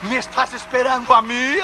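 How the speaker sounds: high, astonished question; incredulous. Retold or imitated. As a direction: ask it as if you cannot believe it. Man astonished the guard expects him.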